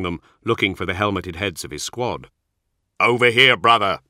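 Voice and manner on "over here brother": loud, raised call to a comrade; shout. A narrator's reading. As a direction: call out over a distance. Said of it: "Cole shouted".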